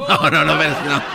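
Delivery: laughing through the no's. Real, not acted. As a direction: brush it off with a real laugh. Laughs at the teacher's boast. (negando entre risas)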